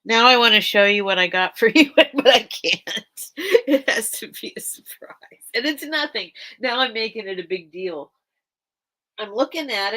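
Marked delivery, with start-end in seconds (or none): 0.1-5.2 fast, loud, laughing through the words; giggling over a secret gift; laughing excitedly. Real, not acted. Teasing a gift surprise.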